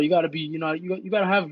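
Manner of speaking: ordinary talk, nothing marked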